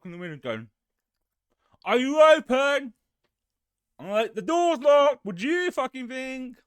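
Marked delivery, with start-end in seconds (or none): 1.8-2.9 high, very loud drawn-out shout; calling through window. Retold or imitated. Retold customer calling through window. 4.3-6.6 loud, high-pitched indignant shout; exasperated retort. Retold or imitated. Retold exasperated reply to customer.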